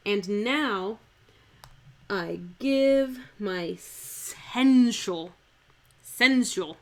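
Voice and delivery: slow, emphatic verse with "sensual" in two syllables. Shown as a demonstration. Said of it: "make this word two syllables".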